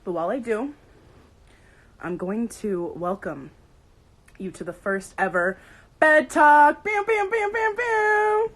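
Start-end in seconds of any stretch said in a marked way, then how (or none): 6.0-8.5 loud title, then high horn blasts; show announcer hype. Pretend. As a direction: announce it and imitate an air horn.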